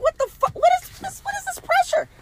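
very high, startled shriek; shocked shriek. Retold or imitated. To her partner mid-sex, a very high startled shriek. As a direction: caught completely off guard.

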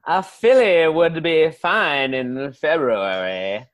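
put-on slow, drawn-out southern accent; genteel southern accent. Southerner accepting an introduction to a lady, southern accent. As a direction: relish it like a southern gentleman. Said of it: "genteel way that you guys talk".

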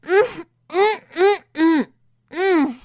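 high, loud closed-mouth cries; muffled gagged screams. Acted. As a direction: scream through a gag in short bursts. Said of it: "screaming her head off into her gag".

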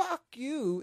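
high, loud burst of outrage; playful indignant outburst; mock indignant. Real, not acted. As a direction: fire back, offended on the film's behalf. Indignant defence of a favourite film.

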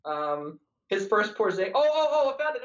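usual speaking voice

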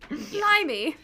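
high, mock British exclamation in a British accent; playful British accent blurted out. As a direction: toss it off like a cheeky Brit in a British accent. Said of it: "as they say in England".

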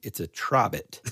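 ordinary talk, nothing marked

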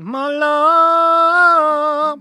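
high, loud, long-held final note; hammed-up melodramatic cry; theatrical wail. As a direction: belt the last word out and hold it. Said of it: "He's been waiting to do that".

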